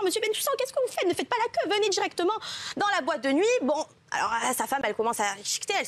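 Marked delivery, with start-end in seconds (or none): 0.0-3.4 high, fast, eager bouncer call. Acted. Bouncer hailing a regular at the club door, high and eager. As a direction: hail the regular warmly and quickly, pitched high. Bouncer calls him by name.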